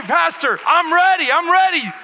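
high, loud, fired-up cry of readiness; eager shout. Retold or imitated. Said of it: "You say".

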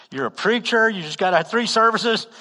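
high, loud, incredulous reminder; acted reproof. The Lord reminds the preacher who he is, loud reproof. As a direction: drive it home with mock exasperation.

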